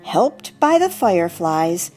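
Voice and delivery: ordinary delivery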